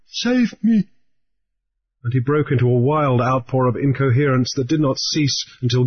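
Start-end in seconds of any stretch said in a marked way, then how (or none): 0.1-0.9 high, desperate plea; high, frantic begging; Harelip pleading. A narrator's reading. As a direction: beg for your life in a high voice. Harelip begs Grief.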